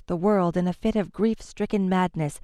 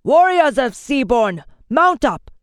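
much louder, higher rallying shout; 6 semitones higher, 8 dB louder than usual. A narrator's reading. Lord to his warriors, loud rallying shout. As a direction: call the troops across the field. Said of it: "great Lord Seaborn shouted".

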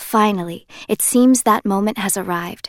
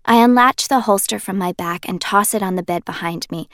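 plain reading voice

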